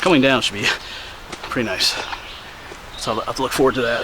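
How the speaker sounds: ordinary delivery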